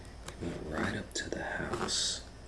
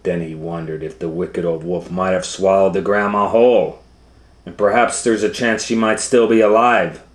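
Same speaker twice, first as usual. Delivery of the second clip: excited story narration; 4 semitones higher, 14 dB louder, faster than usual. A narrator's reading.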